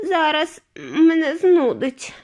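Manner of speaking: high, loud, slow sick wail; queasy cry. A narrator's reading. Mrs TV wailing she feels sick, high and slow. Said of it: "закричала".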